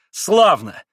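loud, malicious exclamation; spiteful cry. A narrator's reading. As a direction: shout good riddance without mercy. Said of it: "злобно и беспощадно крикнула опять Грушенька".

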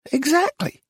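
very high, relieved; high approving exactly. A narrator's reading. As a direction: agree brightly. Missus Lacy approving.

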